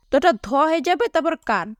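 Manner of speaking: ordinary delivery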